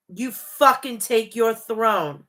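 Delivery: ordinary talk, nothing marked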